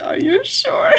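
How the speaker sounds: high-pitched, rising question; doubtful check. Acted. Player looking at him in doubt, high sceptical question. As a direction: ask it high, sceptical of what you heard.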